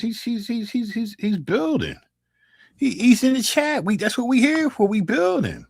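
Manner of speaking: high, teasing put-on voice, well above his own; mock chatter voice. Teasing a silent viewer in chat, high mock voice. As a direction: poke fun at the silent viewer in a squeaky voice.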